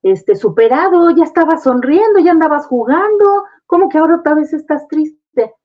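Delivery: parent's exasperated voice, rising in pitch. Pretend. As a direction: scold the child for being sad again. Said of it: "cometer el error de decir".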